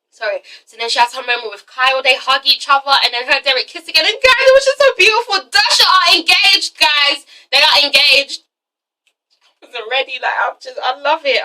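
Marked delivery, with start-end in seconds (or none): 4.0-7.2 high-pitched, thrilled announcement; excited gushing. Real, not acted. Fan telling viewers the couple are engaged, gushing. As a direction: gush with real excitement, pitch raised.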